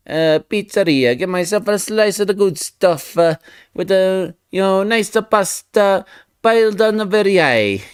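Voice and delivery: hammed-up Italian pizzeria accent; mock Italian accent. Artist riffing to chat about pizza in a mock Italian accent. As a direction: play a cheesy Italian pizza lover. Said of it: "Italian allegations".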